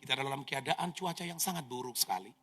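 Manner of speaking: calm the passengers like crew on the intercom; flight attendant announcement. Retold or imitated. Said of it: "Pramugari mulai ngomong".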